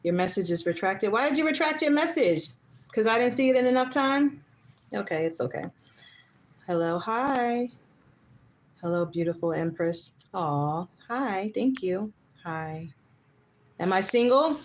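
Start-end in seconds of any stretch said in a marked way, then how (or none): none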